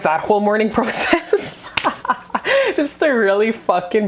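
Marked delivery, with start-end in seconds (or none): 1.7-2.7 burst of laughter. Real, not acted. Laughs mid-story about mourning.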